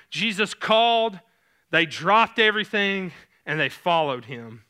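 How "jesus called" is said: ordinary talk, nothing marked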